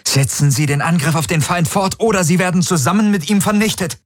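high, urgent, commanding threat; angry ultimatum. A narrator's reading. Admiral warning her own fleet, angry and afraid. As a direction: issue a high-pitched final warning, driven by anger and fear. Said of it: "Ihre Stimme zitterte vor Zorn, vor Furcht".

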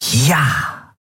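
pleased, victorious exclamation; triumphant. Acted. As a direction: celebrate a small victory. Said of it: "Endril triumphierte".

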